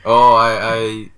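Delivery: ordinary talk, nothing marked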